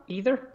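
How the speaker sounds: "Either" with a long E and a rising end; EE-ther pronunciation. Shown as a demonstration. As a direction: answer with "Either" starting on ee. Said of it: "Is it either or, or either?".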